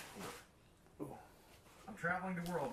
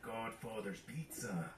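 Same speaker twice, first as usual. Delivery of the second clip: put-on Irish accent; 5 semitones lower, 4 dB quieter, much slower than usual. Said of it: "Why are you doing an Irish accent?".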